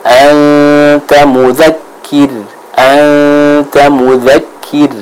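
stretched recitation, mudzakir closing thin. Shown as a demonstration. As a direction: hold the n of anta long, end mudzakir on a light R. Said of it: "tipis, ya".

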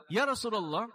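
questioner's plea. Retold or imitated. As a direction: ask it high and bewildered, lightly voiced. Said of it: "kepada beliau oleh seseorang".

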